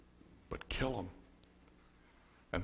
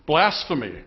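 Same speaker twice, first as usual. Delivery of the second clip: loud, high cry of accusation; explosive shout of condemnation; outraged yell; 6 semitones higher, 11 dB louder than usual. Acted.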